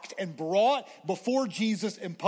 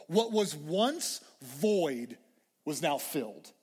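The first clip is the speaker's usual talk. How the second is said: slow, measured preacher's cadence; slow and weighty, building the contrast; slow dramatic build; slower than usual. Acted. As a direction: lay each phrase down slowly.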